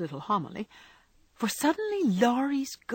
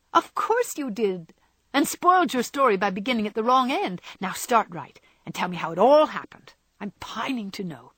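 eager, impatient Jo; 4 semitones higher, 5 dB louder than usual. Acted. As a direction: chide him, then press eagerly for the story. Jo's voice, impatient to hear.

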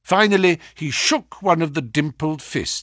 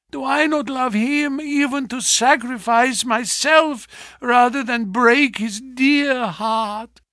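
anguished wife; 13 semitones higher than usual. Acted. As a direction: pour out her hurt, slowing on the last words. Wife's rising anguish.

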